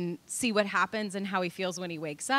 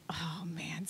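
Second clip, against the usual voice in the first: breathy whisper, almost silent; whispered oh man; 5 semitones lower, 13 dB quieter than usual. Retold or imitated. Mother dreading the injury, whispered under her breath. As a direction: mutter it under your breath like you saw it coming. Sudden dread mid-wrestling.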